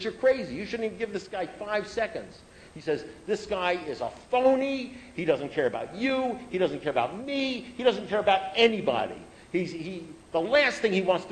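retold righteous rant; heated rant. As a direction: tear into the guy, all worked up. Retelling his own rant against Bobby.

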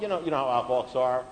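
ordinary delivery

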